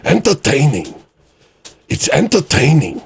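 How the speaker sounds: lightly voiced Chris Lestrange; Chris Lestrange impression. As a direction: mimic Chris Lestrange's delivery. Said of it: "Why does that sound like Chris Lestrange?".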